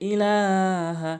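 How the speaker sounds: ইলাহা with লা drawn one alif, very slow; stretched ilaha. Shown as a demonstration. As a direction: pull the লা long in ইলাহা. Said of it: "লাম এক আলিফ টানতে হবে". (ইলাহা লাম এক আলিফ টেনে)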